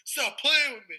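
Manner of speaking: high, loud mock-angry yell; imitated outburst. Castmate as votes go out, imitated angry yell. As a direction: impersonate someone snapping in exasperation.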